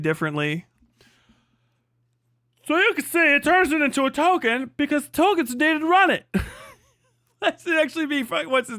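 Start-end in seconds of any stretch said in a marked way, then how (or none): 2.7-6.3 mocking paraphrase.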